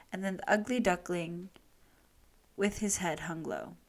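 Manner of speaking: usual speaking voice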